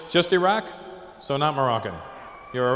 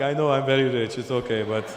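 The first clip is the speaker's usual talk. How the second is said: lower, put-on Saudi accent; rich Saudi impression; 4 semitones lower than usual. Saudi guy acknowledging riches, put-on Saudi accent. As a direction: shrug off wealth in a Saudi accent.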